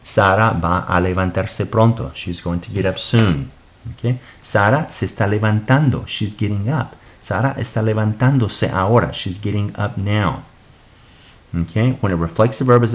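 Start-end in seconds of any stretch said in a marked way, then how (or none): none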